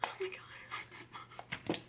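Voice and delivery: hushed amazed whisper; whispered disbelief; whispered surprise. Real, not acted. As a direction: whisper it, shocked. Surprised at mom's progress.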